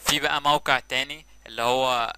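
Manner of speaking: ordinary talk, nothing marked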